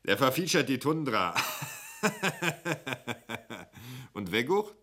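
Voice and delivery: high, loud, laughing between gags; lachend, hoch und laut; laughing jokes. Acted. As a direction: crack jokes and laugh at them. Schwendling joking, laughing.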